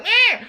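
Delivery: a sudden, very high mock wail, short and sharp; imitating a crying kid. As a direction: squeal it out like a hurt child. Crying child imitated in story.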